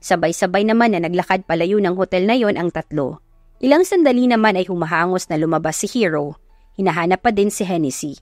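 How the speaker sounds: usual speaking voice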